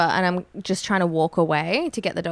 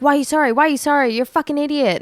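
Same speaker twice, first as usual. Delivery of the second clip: higher-pitched mock yell, mildly angry; retold yelling; 8 semitones higher, much faster than usual. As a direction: imitate an angry stranger scolding, pitched up. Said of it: "she was just yelling at me".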